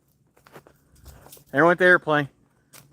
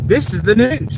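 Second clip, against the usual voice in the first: a put-on newsreader line; lightly hammed news anchor; mock anchor voice; 3 semitones higher than usual. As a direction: announce it like a TV anchor.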